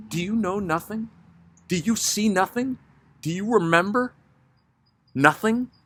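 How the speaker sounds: high, anxious pleading voice; a restless woman begging to be answered; nervous woman. Acted.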